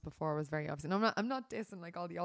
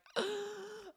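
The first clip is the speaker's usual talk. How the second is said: excited gasp; 14 semitones higher than usual. Retold or imitated. Excited to meet a German.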